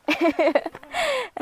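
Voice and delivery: a high, bright burst of laughter; high giggle. Real, not acted. High giggle while chatting with her mother-in-law. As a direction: let a real giggle break out.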